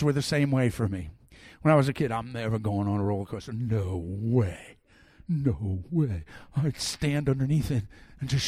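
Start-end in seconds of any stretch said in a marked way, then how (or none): 2.1-6.2 low-pitched, emphatic retold refusal; mock firm refusal. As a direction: dig in like a kid refusing, voice dropped. Voices his scared childhood refusal.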